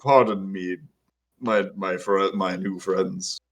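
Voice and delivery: low, slow, soft character voice; puzzled and polite, low and unhurried; lost, puzzled Borak. Acted. Borak asks after the source of a strange voice, puzzled. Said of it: "he's just like".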